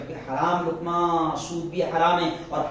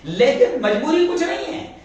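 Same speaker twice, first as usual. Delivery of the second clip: louder, higher, emphatic denial; forceful rebuttal; 7 semitones higher, 7 dB louder than usual. Acted. Preacher rebutting the teacher's excuse, loud and emphatic. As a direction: hammer home that there is no compulsion.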